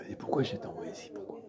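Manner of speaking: puzzled whisper to himself; hushed, inward questioning; whispered wondering. Acted. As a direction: wonder under your breath. Pupil's puzzled thought to himself.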